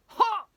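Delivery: loud, high leaping "ha"; sharp exertion shout; effort cry. A narrator's reading. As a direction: burst it out mid-leap. Teacher leaps for imaginary ball.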